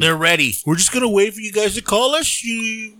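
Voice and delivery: high-pitched hint of a presidential impression; lightly mock a president promising to wait for a call; Biden sounding like Trump. Host mocks the president waiting for calls, Trump-like impression. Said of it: "That sounded like Trump to me".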